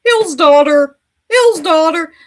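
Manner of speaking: high, loud taunting mimicry; put-on high mocking voice; mocking imitation. Repeats her earlier mocking imitation.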